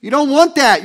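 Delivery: usual speaking voice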